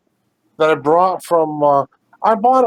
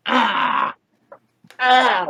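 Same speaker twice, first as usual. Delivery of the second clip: playful yelp; 7 semitones higher than usual. Real, not acted. Playful shouts to pet.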